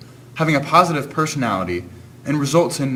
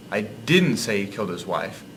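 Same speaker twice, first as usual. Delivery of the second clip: "didn't" lifted in pitch above the rest; stress on DIDN'T; 3 semitones lower, 3 dB quieter than usual. Shown as a demonstration. Narrator showing how inflection changes meaning, stress on "didn't". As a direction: punch the word "didn't". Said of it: "changing the inflection of the other words".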